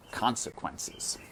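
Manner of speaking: hushed, breathy chapter title; whispered title. Shown as a demonstration. Narrator announces the new chapter in a slow whisper. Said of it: "chapter title read".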